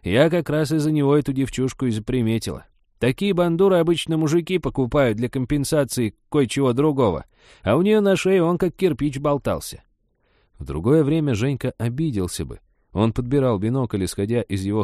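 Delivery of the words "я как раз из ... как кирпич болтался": high, smug granny voice; pleased with herself, pitched up; self-satisfied old woman. Acted. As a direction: boast of what you noticed. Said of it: "теперь самодовольно".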